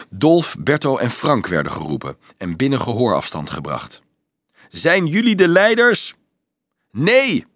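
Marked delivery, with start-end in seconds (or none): 4.7-6.1 high, raised-voice demand; calling down. A narrator's reading. Officer to the three boys below, calling down. 6.9-7.5 high, forceful shout. A narrator's reading. Dolf to officer on the battlements, shouting up. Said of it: "schreeuwde Dolf naar omhoog".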